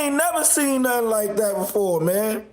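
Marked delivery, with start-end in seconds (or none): none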